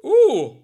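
loud delighted laugh, then a high ooh. Real, not acted. Reacting to the lawyer's retort.

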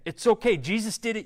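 normal talking voice

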